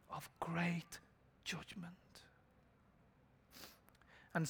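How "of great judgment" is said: grave whisper. Acted. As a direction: whisper it ominously. Solemn warning of judgment.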